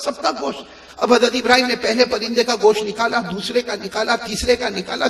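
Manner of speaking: soft preacher's storytelling; hushed story narration. A narrator's reading. Preacher recounts Allah's orders to Ibrahim, hushed narration. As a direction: draw the congregation in softly.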